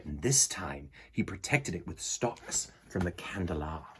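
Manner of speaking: put-on storybook narration for kids; reading to children. A narrator's reading. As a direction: read the story aloud to the class. Reads picture book to children.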